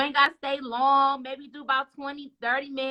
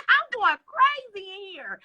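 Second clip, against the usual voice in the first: mock exasperation; 7 semitones higher than usual. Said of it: "I was like".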